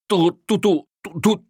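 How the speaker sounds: very high, loud stammering; agitated stutter. A narrator's reading. Insulted burglar fires back at the policeman, stuttering with agitation. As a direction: stutter with outrage, stuck on "du". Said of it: "Der Mann stotterte vor Erregung".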